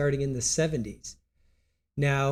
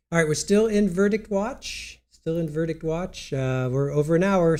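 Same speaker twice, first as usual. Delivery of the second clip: usual speaking voice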